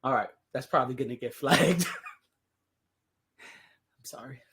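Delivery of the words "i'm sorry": breathy laughing apology. Real, not acted. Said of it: "I've had too much fun".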